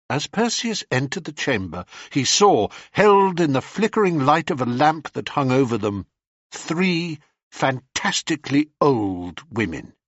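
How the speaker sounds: usual speaking voice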